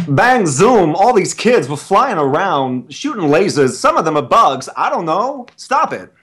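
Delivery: high-pitched, halting celebrity impression; loud, high swoops with oddly stretched phrasing; Christopher Walken. Performer reading a book for the chat as a Walken impression. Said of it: "doing Christopher Walken's interpretation".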